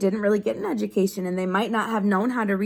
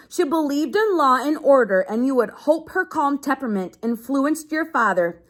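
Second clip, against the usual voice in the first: steady read-aloud to a class; reading to children; 3 semitones higher than usual. Acted. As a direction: read the page out to the kids. Teacher reads a book aloud.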